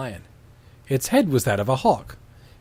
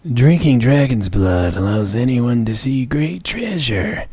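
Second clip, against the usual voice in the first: slow, grave creature voice; solemn griffin; 4 dB louder, slower than usual. Acted. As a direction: intone it like an old oracle. Reads griffin lines in voice.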